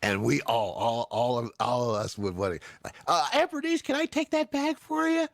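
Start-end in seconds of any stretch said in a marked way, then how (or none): 3.1-5.3 put-on high, sweet kid asking a favour; eager kid. Child offering to carry the aunt's bag, high and sweetly eager. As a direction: play an overly helpful child.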